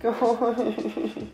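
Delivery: high, soft giggle; delighted giggle. Real, not acted. Admiring a shiny book cover, delighted giggle. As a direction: giggle quietly in a high pitch.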